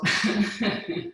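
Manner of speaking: airy unvoiced forced laugh; deliberately faked laugh to get going; breathy fake laugh. Shown as a demonstration. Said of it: "just go".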